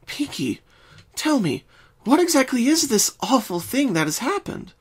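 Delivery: steady her with a calm voice; reassuring. Acted. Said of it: "tried to reassure Pinkie".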